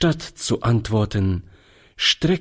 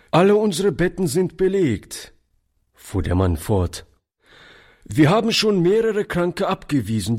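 much higher, brisk official voice; a lightly voiced stiff porter; officious porter; 6 semitones higher than usual. A narrator's reading. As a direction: brush off the old man by the rules. Porter refuses the sick child.

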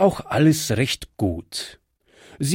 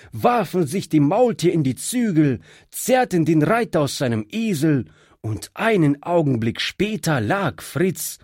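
ordinary delivery, nothing marked

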